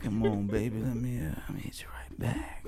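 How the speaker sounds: low, hushed mock-seductive whisper; love doctor mode. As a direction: fade into a smooth lover's whisper. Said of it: "I'm in my love doctor mode".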